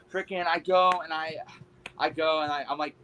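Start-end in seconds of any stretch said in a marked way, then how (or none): none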